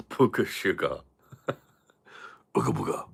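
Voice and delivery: "Booger sugar" said through a laugh; laughing repeat. Real, not acted. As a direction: chuckle as you say "Booger sugar". Repeats phrase while laughing.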